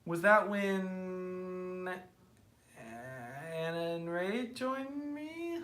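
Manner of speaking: slow, tentative question climbing high and soft; drawn-out recalling. Real, not acted. Host trying to recall who joined, slow drawn-out question. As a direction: feel your way to the memory, stretching words.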